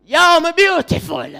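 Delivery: a loud Jamaican patois accent; patois version. Shown as a demonstration. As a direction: belt it out in patois. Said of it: "try to say".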